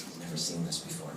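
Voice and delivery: soft, hushed echo with a laugh; quietly parroting him; a hushed imitation of the girl echoing; mock echo. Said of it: "She goes".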